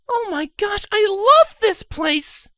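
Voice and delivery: very high, loud squeal, slow on love; squealing with delight. A narrator's reading. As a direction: squeal it like a thrilled fan. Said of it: "Scarlet Near squeed".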